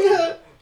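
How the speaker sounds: sudden high laugh; burst of laughter. Real, not acted. Laughing at his co-host's remark, high burst of laughter. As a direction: crack up out loud.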